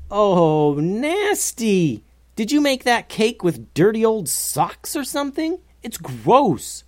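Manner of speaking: high, revolted outburst; disgusted. A narrator's reading. Tim spits out foul cake.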